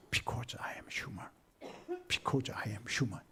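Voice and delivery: slow, very soft half-whisper; hushed confession. Acted. Explaining Jesus acted so being human, hushed and slow. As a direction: draw it out quietly, almost under the breath.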